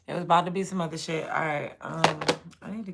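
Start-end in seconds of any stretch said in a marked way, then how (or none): 0.1-1.2 louder, fast, punchy; emphatic repeat. Real, not acted. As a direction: hit it harder the second time.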